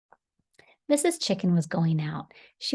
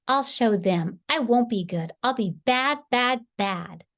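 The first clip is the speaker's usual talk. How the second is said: loud, higher cross vow; defiant shouting; 6 semitones higher, 5 dB louder than usual. A narrator's reading. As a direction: shout it out in a sulky temper. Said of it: "shouted Big Bad Wolf".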